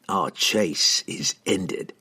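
calm killer stating a fact; cold assassin. A narrator's reading. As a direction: assassin telling the mage coldly. Entreri speaks.

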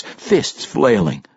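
ordinary delivery, nothing marked

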